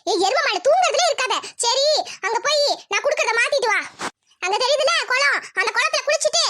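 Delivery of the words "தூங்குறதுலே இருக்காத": ordinary talk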